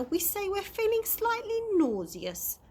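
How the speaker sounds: high, posh-mannered correction; prim mother. A narrator's reading. Mother correcting daughter's word in the car, high and prim. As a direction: put on the refined voice, primly. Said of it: "corrected Mother".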